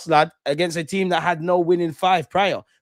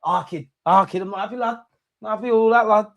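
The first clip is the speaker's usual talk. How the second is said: higher-pitched mock Scouse whinge; mock Scouse accent; 8 semitones higher than usual. Vlogger mimicking upset Liverpool fans, higher mock Scouse whinge. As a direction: do a whiny Scouse fan, lad. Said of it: "I need to hear them accents".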